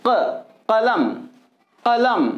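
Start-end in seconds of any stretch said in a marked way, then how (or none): 0.1-1.0 क and कलम said with the k far back in the throat, like a deep q; demonstrating Urdu qaf; deep qaf sound. Shown as a demonstration. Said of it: "इसको हलक से निकाला जा रहा है".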